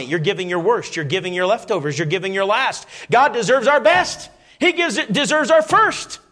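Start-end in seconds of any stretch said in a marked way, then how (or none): 3.1-6.2 fervent preaching; preaching at full force. Real, not acted. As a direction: thunder the verse to the congregation.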